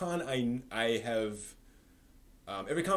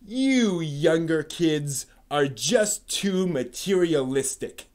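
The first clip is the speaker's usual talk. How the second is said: put-on loud, slow and higher, lecturing the kids; hammed-up holier-than-thou sermon; mock pompous reading; 5 semitones higher, 10 dB louder, slower than usual. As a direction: read it like a smug scold. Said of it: "someone has a chip on, on their shoulder".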